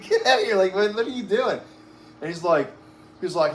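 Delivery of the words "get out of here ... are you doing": higher, scoffing brush-off; scoffing dismissal. Retold or imitated. Man in his backyard waving off the cop, higher scoffing brush-off. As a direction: laugh the cop off as ridiculous.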